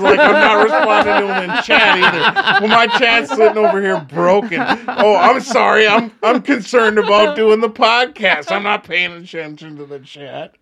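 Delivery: laughing through the words; flustered laughing apology. Real, not acted.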